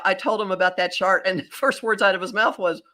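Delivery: ordinary talk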